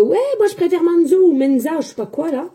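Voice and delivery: lightly mocked fussy follower; mocking a commenter. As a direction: parody the comment in a whiny put-on voice. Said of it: "à écrire".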